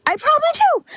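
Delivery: high squeaky self-admission; rueful admission. Real, not acted. Streamer admitting she trauma dumps, high sheepish squeak.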